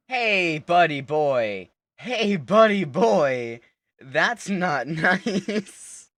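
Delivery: loud, high, drawn-out call to a buddy; playful loud telling-off; mock scolding call. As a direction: holler it slowly, stretching each word. Calling out to game character.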